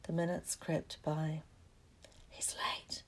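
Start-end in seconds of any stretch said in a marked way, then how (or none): 2.3-3.0 soft, voiceless whisper. A narrator's reading. Ron waiting for Malfoy in the trophy room, whispering. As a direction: whisper it furtively. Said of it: "Ron whispered".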